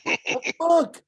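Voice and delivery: very high squeaky shocked voice; stunned outrage. Retold or imitated. Referee reacting to the pay gap, very high squeaky outrage. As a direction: squeal it in total disbelief.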